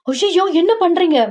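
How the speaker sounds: very high, startled protest; alarmed yelp. Acted. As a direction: cry out as if suddenly lifted up. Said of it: "நீ கத்துனா". (பதறி உச்சக் குரலில் கத்தல்)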